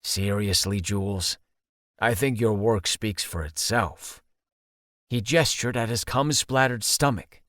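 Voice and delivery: low, slightly embarrassed guy. Acted. Seamus voiced by narrator.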